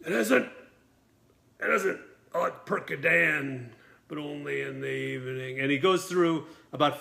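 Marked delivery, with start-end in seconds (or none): 0.1-5.6 dazed drugged musing, long pauses; Burt Reynolds woozy. Retold or imitated. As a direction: drift through it like a painkiller-addled actor. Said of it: "kinda lost".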